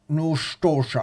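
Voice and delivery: hammed-up movie Russian accent; stagey Russian-movie delivery. Shown as a demonstration. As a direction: put on bad film Russian. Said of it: "I can speak movie Russian".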